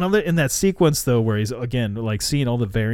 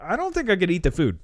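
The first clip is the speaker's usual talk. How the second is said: usual speaking voice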